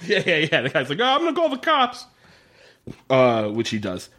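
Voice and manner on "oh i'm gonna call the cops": put-on feeble old guard. Guard voiced, feeble threat.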